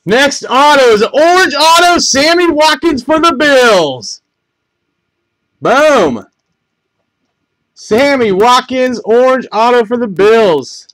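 loud, high-pitched excited call of a big hit; excited announcement. Real, not acted. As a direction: announce the big pull at full volume. Announcing an autograph card hit.

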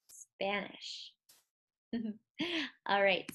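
ordinary talk

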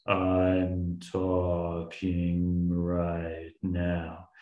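very slow, deep, dragged-out words like a slowed recording. Shown as a demonstration. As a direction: stretch each word out low and slow like a tape played too slow. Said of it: "I slow it down, it becomes".

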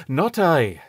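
curt quick no; said quickly. A narrator's reading. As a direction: snap it back fast. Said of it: "said the doctor quickly".

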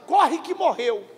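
ordinary talk, nothing marked

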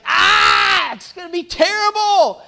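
very high, loud wail imitating his son; mock freak-out. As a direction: scream it like a kid in full meltdown. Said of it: "He starts freaking out".

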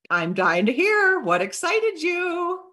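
high, drawn-out eagerness; keen, lifted voice; eager follow-up. Acted. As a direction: stretch it slowly, dying to know. Demonstrating eager follow-up message.

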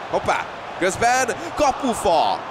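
sudden high shout at a post hit; excited sports commentary. Real, not acted. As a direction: yell it out as the ball hits the post. FIFA esports commentator sees a post hit.

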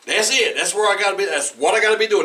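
an imagined busy person's eager rush; eager fixation. Retold or imitated. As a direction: blurt it like someone fixated on getting there. Preacher voices a busy person's fixation.

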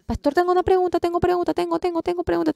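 high, quick eager asking voice; a pastor bursting to ask his question; a pastor pushing in with his question, pitched high. Retold or imitated.

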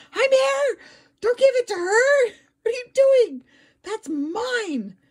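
high, loud whiny cat protest; demanding cat voice. Retold or imitated.

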